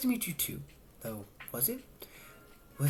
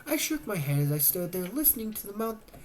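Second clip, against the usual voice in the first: usual speaking voice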